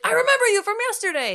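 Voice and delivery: high, loud, fast imitated shriek; thrilled character outburst. Imagining Mickey speaking up to Nathan, thrilled shriek. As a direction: burst out as if ripping off the mascot head. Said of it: "take off its head and be like".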